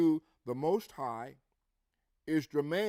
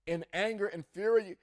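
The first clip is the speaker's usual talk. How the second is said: raised, driving scripture reading; 4 semitones higher, 4 dB louder than usual. Acted. Preacher reading Ezekiel aloud to congregation, proclaiming with force.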